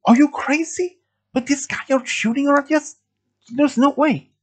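very high, incredulous protest; alarmed disbelief. A narrator's reading. Friend objecting to finding help, high alarmed disbelief. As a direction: squeak it out in disbelief. Alarmed reply to risky plan.

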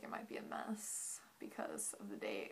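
whispering low and hushed; whispered. Retold or imitated. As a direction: hush it like a secret. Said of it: "my friend was like".

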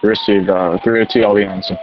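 loud radio acknowledgment. Acted. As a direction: answer through a handheld radio. Engine acknowledging over radio.

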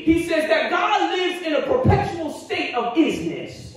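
rising, ringing preacher cadence; full preaching. Acted. Pastor preaching to his congregation, full force. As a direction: preach it out to the pews.

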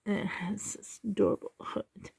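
whispering. Real, not acted.

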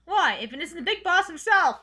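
boss greeting. Acted. Boss character greets players.

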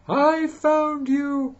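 high, slow, drawn-out gloating; gleeful found you. Acted. Papa Bear finds the cub on his shoulders, high and slow. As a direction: stretch it high and slow, pleased. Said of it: "said Papa Bear".

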